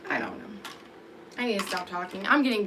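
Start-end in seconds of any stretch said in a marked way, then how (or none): 0.1-0.7 whisper. Real, not acted. Trailing off, sleepy.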